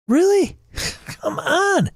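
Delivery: high-pitched, incredulous laughing protest. Real, not acted. Streamer scoffing at someone upset over content warnings, incredulous.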